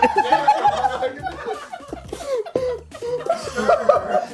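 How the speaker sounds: long, high, helpless laughing fit; hysterical laughter. Real, not acted. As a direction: laugh until you can't stop.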